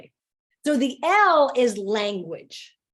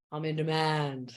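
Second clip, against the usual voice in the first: lowered voice; 8 semitones lower, 4 dB quieter than usual. Shown as a demonstration. Swapping overwhelm for a new mantra, lowered voice. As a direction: drop the pitch and own it. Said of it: "And I lower my voice".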